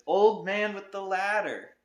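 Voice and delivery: loud, high, slow last-breath shout; boy's final desperate shout. Acted. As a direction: yell with the last bit of breath. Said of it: "he shouted".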